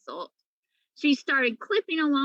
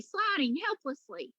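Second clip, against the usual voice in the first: ordinary delivery, nothing marked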